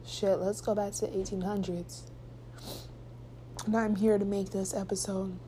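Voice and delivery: quiet, grieving voice; tearful. Real, not acted. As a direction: speak softly through tears.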